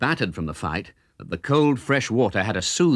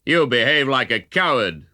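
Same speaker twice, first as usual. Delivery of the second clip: a louder mocking jeer; taunting; 3 semitones higher, 3 dB louder than usual. A narrator's reading. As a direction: goad him into a fair fight. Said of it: "the stranger taunted".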